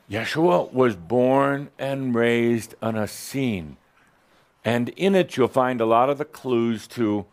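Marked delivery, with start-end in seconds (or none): 0.1-3.8 slow and deliberate. Real, not acted.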